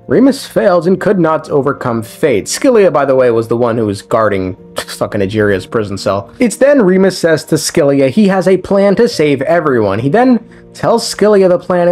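ordinary delivery

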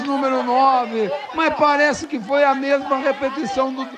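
ordinary delivery

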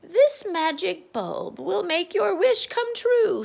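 high, loud, slow magical witch. Acted. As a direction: announce the magic slowly like a witch. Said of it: "she said".

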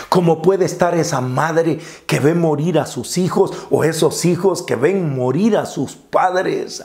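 normal talking voice

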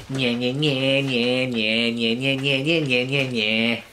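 teasing singsong taunt; taunting nya nya. Acted. Mummy taunting the servants from secret rooms, singsong. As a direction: tease them from hiding.

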